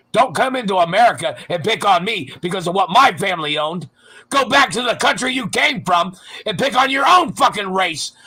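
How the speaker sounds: loud, high-pitched yelling rant; raging yell at his imagined tormentors; furious shout. Real, not acted. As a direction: scream it out in real rage. Angry rant at persecutors.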